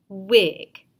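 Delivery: loud answer Wig; proud pupil reply; student answer. Shown as a demonstration. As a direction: call the blended word out. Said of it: "Student says".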